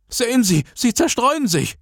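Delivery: high, urgent screech. A narrator's reading. Teacher about to be shoved off roof, screeching. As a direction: cry out frantically, voice far up high. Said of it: "keifte dieser".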